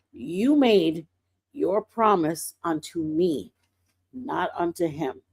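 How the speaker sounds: lightly voiced Lord, low and measured; gently firm divine correction; the Lord's correcting voice. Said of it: "the way he talks to me".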